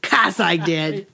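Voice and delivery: put-on slow, drawn-out Boston accent; mock swoony Boston drawl. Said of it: "You cunning thing, you".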